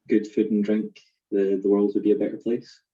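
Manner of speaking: ordinary talk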